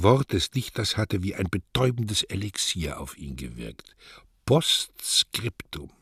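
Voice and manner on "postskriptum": slow drawn-out word. Acted. Neruda's recorded voice says it.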